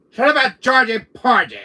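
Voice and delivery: loud, high angry yell. Acted. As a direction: snap at him at full volume. Yelling at the clown.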